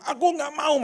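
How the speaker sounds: put-on high, whiny child's voice; child refusing. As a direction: voice a scared toddler refusing. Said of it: "Dia bilang". (anak kecil menolak dengan suara tinggi)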